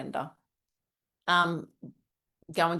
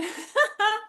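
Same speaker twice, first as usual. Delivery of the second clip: a sudden high giggle; high burst of laughter. Real, not acted. Laughing at the "I am opinionated" affirmation on the brochure, high giggle.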